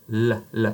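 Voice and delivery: "la" with the tongue tip on the top teeth; light clear French "la". Shown as a demonstration. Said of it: "So you're gonna say".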